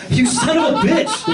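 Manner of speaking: high, delighted mock outburst; jokingly cursing the guy who passed him crack; mock outrage. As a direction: yell it in gleeful fake fury.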